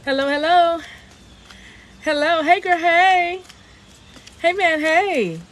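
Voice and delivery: high, bright-pitched welcome calls; high sing-song hellos to arriving viewers; sing-song greeting. Real, not acted. Livestream host greeting viewers in high sing-song calls.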